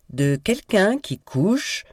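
high, slow, deliberate accusation; insistent revelation. A narrator's reading. Kit presses on despite his sister's protest, slow insistent accusation. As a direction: draw it out over the interruption.

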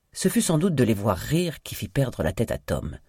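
plain reading voice

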